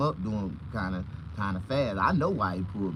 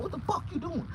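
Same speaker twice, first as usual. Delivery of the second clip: high mock cop. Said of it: "Talking about".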